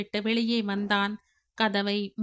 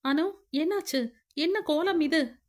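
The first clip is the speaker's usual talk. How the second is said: a higher, falsely worried man's voice; feigned concern; 6 semitones higher than usual. Acted. Siva pretending ignorance to Anu, mock worried. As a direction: pretend you know nothing, ask anxiously.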